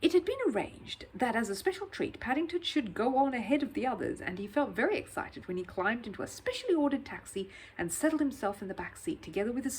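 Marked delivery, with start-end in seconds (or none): none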